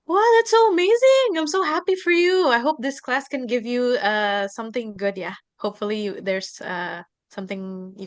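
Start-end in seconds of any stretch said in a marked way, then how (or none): 0.0-2.5 bright, delighted praise; excited. Real, not acted. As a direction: cheer her on with real joy. Teacher thrilled by student's news.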